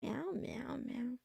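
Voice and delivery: soft unvoiced cat meows; whispered meowing. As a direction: breathe out little kitten meows. Playful cat noise to herself.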